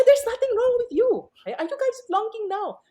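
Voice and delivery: exasperated teacher insisting her students are fine; exasperated insistence. Retold or imitated. Repeats answer, exasperated.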